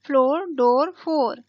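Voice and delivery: rhyming words spoken one by one; repetition drill. Shown as a demonstration. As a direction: drill "floor, डोर, फोर" word by word, stressed. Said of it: "इस तरह से आप बार-बार बोलेंगे". (दोहराकर अभ्यास कराते हुए)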